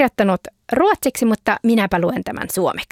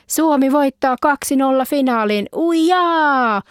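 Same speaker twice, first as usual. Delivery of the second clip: high, jubilant stadium cheer; crowd cheer; 5 semitones higher, slower than usual. Acted. Fans celebrating Finland into the final, high jubilant cheer. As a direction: roar it like fans after a win.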